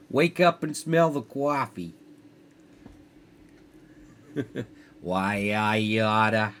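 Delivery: a broad, jokey New York tough guy; New York accent. Pretend. Gamer laughing through his own New York accent. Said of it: "Maybe my New York is better".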